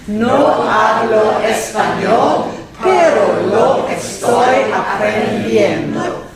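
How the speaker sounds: very slow, drawn-out line for a class; slow model. Shown as a demonstration. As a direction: stretch each word so learners can join in. Teacher models sentence for repetition.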